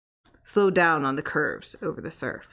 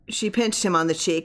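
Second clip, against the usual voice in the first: ordinary delivery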